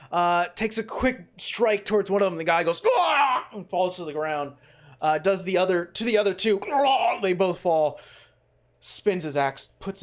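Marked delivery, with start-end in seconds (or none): 3.0-3.5 sharp yelp of a man struck; short loud pained yelp; high pained cry. Acted. Thug hit by an axe, high pained yelp. 6.7-7.2 sharp yelp of a man struck; short loud pained yelp; high pained cry. Acted. Thug hit by an axe, high pained yelp.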